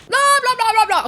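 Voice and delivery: high babbling blah-blah; mock yapping. Loud man the second we land, mock yapping. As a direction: imitate a loudmouth starting straight up.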